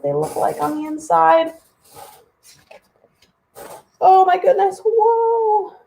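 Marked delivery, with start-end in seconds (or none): 4.0-5.8 a delighted gasp of wonder at the sight; amazed. Real, not acted. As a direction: wow them with surprise. Reacting to the party scene.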